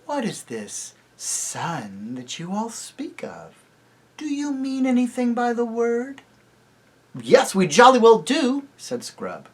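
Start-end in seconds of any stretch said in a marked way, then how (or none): 0.0-6.2 soft cooing. A narrator's reading. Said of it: "cooing softly". 7.2-8.7 defiant. A narrator's reading. Scrub answers defiantly.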